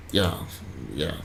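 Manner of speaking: ordinary talk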